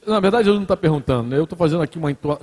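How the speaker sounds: ordinary talk, nothing marked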